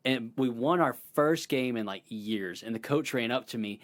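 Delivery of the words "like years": ordinary delivery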